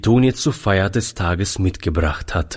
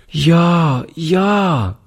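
put-on high, eager little girl cry; excited child call; 8 semitones higher than usual. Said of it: "rief die Kleine".

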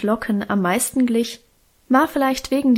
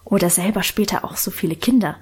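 usual speaking voice

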